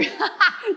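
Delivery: a quick, high burst of genuine laughter; real laugh. Real, not acted. Instructor amused by her own rambling, genuine laugh. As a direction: let the laugh just pop out.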